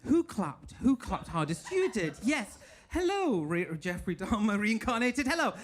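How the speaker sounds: usual speaking voice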